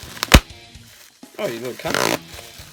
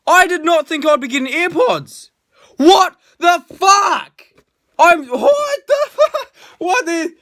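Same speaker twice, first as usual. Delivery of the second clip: shocked shout; 13 semitones higher, 9 dB louder, slower than usual. Real, not acted. Finds AirPods in box.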